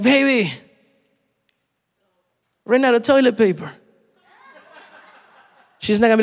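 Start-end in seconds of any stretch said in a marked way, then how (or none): 0.0-3.7 high-pitched calling through the house; mock yell to wife. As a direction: holler a household request to your wife, playfully. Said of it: "screaming at my wife".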